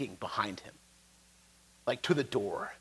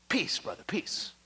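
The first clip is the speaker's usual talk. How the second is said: soft, half-whispered plea; placating halfling; 9 dB quieter, slower than usual. Acted. Halfling soothes the armed intruder behind him, soft placating near-whisper. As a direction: hush it, calming a comrade. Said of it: "the halfling's like".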